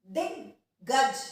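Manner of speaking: दिग्गज slowly with a hold on दिग before गज; slow careful pronunciation. Shown as a demonstration. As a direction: say दिग्गज slowly, pause on the doubled ग. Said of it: "अब इसमें दिग रुक रहे हैं हम". (धीरे से दिग्गज, दिग पर रुककर)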